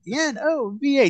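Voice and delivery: put-on high-pitched child spelling out letters; imitated daughter's promo. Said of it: "then she's like".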